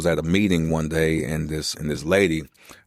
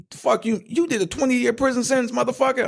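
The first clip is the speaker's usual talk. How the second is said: much higher, stunned disbelieving outburst; Sam Jackson, astonished. Retold or imitated. Sam Jackson hearing about my prison sentence backstage, shocked high outburst. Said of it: "Sam Jackson was like".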